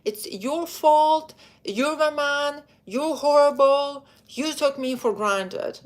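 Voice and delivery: high, loud, slow accusing outburst; mock blaming rant. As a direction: hurl the blame at him. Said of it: "You go like".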